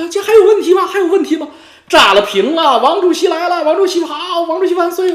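high, loud, crowing victory shouts; gloating triumph. Acted. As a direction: cheer himself on like a crowd hailing its chairman. Imagines fans cheering his win.

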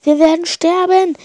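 high, loud terrified cry; fleeing boy sure he will die; panicked. A narrator's reading. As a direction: scream it in despair.

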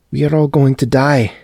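a panicked wife breaking into tears; weeping. A narrator's reading. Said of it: "Olga wept".

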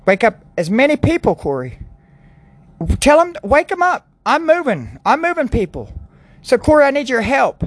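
high, ringing voice of God calling; voicing God. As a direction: call out as God urging him on, high. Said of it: "You said".

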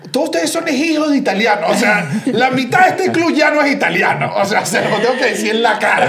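high-pitched, laughing tell-off; mock exasperation. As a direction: tease them as if telling them off to their faces. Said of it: "Era y que".

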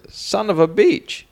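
high, drawn-out exclamation as the Chinese man; stretched, high-pitched mock cursing; comic character voice. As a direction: voice the comic's punchline, high and slow. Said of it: "And the Chinese man says".

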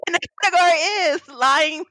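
mock ballroom announcer call; ballroom emcee. Friend playing emcee at a ballroom competition, announcer call.

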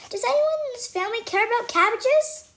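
high, loud frustrated shout. Acted. Dory yelling at her ignored family, high and loud. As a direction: yell it across the dinner table. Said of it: "I shout".

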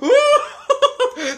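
a burst of high, loud giddy laughter. Real, not acted. Friend recalling the quake laughs high and loud with delight. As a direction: laugh up high like it's a fairground ride. Giddy during earthquake memory.

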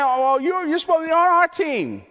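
high, loud mocking whine; mock him for switching sides; sarcastic leaders. Leaders sneering at Nicodemus for leaving their team, high and sarcastic. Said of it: "they sarcastically respond".

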